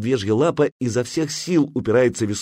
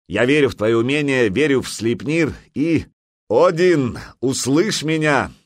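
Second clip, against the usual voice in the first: loud, building to a raised cry; fervent invocation; 5 semitones higher, 4 dB louder than usual. A narrator's reading. Jarl puts faith in helmsman and Odin, fervently. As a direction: call upon the god with conviction.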